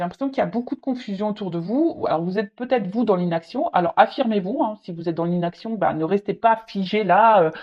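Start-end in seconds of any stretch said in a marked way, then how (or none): none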